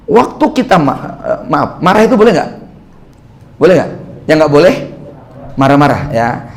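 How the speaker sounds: ordinary talk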